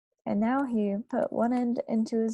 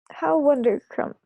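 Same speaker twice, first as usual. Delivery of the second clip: higher, louder joyful cry; cried; 5 semitones higher, 7 dB louder than usual. A narrator's reading. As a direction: beam with delight. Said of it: "cried the B F G".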